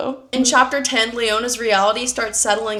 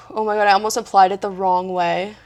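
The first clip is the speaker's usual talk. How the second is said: ordinary talk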